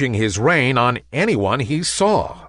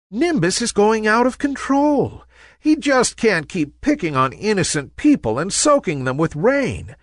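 worried; 10 semitones higher than usual. A narrator's reading. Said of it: "her eyes filled with worry".

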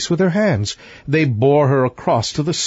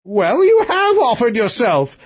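retort; 9 semitones higher than usual. Acted. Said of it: "retorted the king".

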